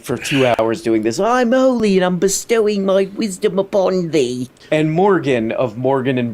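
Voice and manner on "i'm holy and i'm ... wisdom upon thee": lofty mock-sacred voice, pitched higher; hammed-up holy man bestowing wisdom; mock holy guru. Reactor mocking Russell as a holy guru. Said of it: "driest, disapproving older British guy thing".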